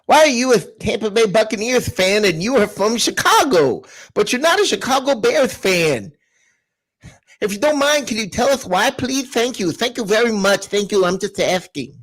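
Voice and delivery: reading out with a toothless lisp; mock lisp. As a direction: read it as if missing a tooth. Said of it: "you basically forget this tooth and you're good".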